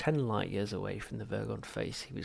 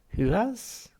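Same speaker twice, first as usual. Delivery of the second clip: much higher, hushed eager question; Halfrunt voice; 12 semitones higher, 7 dB louder than usual. Acted. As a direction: lean in eagerly. Psychiatrist voiced.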